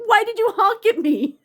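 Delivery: high, loud, laughing mock wail; mock crying demand. Crying woman confronting the honker, loud mock-tearful wail. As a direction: wail the retold complaint through a laugh. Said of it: "like, crying".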